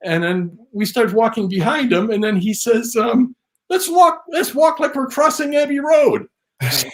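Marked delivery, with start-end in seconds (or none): 3.7-6.3 high-pitched, playful proposal; excited suggestion. Retold or imitated. Teller quoting [name] in the famous jacket, excited suggestion. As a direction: re-enact an eager invitation.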